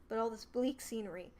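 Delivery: plain reading voice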